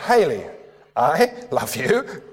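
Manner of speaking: I love you drawn out slowly, rising on you; slow playful I love you; slow teasing demo. Shown as a demonstration. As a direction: stretch it playfully, lift the last word. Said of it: "the intonation does something different".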